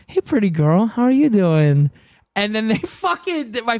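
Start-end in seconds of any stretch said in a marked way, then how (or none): 0.1-1.9 imitating her cutesy pet voice, louder; talking to cat. Retelling how she greets her cat, cutesy pet talk. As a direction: coo it like greeting your cat. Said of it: "I'm talking to my cat and I'm just like".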